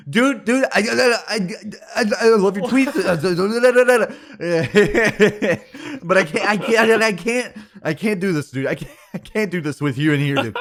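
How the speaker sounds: high, loud flustered starstruck stammer; freaking out. Retold or imitated. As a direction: gush and stammer like a panicked fan. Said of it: "he freaked out on me".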